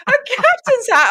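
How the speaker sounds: high, loud, drawn-out incredulous echo; amused disbelief. Retold or imitated. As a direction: repeat it as if it's utterly ridiculous. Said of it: "I'm, like, pissing myself laughing".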